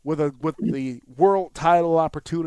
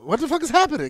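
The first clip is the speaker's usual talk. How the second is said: disbelief; 10 semitones higher, 4 dB louder, much faster than usual. Retold or imitated. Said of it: "I said".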